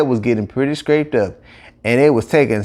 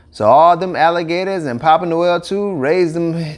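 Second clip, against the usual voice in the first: ordinary delivery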